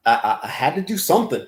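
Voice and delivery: ordinary talk, nothing marked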